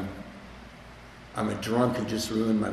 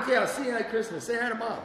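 breezy brush-off; 11 semitones higher, faster than usual. Retold or imitated. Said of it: "it's like swans on a lake".

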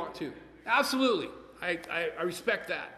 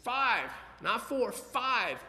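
"five" hammered slowly and higher each time; stress on five; 5 semitones higher, much slower than usual. Real, not acted. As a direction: lean hard on "five, not four, five" at half speed. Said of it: "five people came up to me".

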